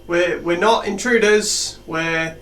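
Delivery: loud, slow call across the room; calling out loud to the were-rats; yelling out. Acted. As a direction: shout it so they hear you're peaceful. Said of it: "I'll yell out".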